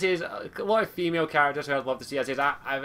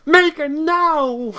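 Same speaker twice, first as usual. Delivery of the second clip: higher, drawn-out bellow right into the mic; close-up shout; 13 semitones higher, 9 dB louder, much slower than usual. Shown as a demonstration. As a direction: shout the command long, close to the microphone. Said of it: "Just, I'll get closer".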